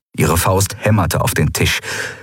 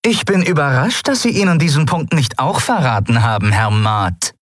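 higher, biting sarcasm; sarcastic; 8 semitones higher than usual. Acted. Captain mocking Sephiron, caustic sarcasm. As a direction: let the mock surprise sting. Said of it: "Der Sarkasmus in Zaylars Stimme war ätzend".

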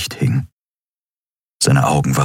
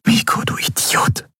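whispered. A narrator's reading. Endriel kneels by Miko and whispers in grief. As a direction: whisper it over his body. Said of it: "flüsterte sie".

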